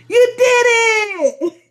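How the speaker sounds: high, squealed cheerleader shout; mock cheer. Reader congratulating the karmic in jest, squealing mock cheer. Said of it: "We got a little cheer for you".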